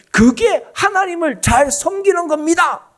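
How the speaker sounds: acted self-righteous assertion, high and loud; loud, high-pitched mock insistence; insistent claim. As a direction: proclaim it like a zealot defending their cause. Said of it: "이렇게 주장할 거예요, 분명히".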